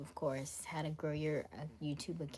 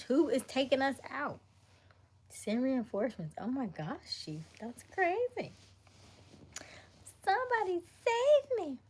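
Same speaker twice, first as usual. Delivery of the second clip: hammed-up panic climbing much higher; mock panic; 8 semitones higher, 5 dB louder than usual. Pretending in the chat role play that the base is falling, mock panic. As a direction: squeal for rescue in pretend terror.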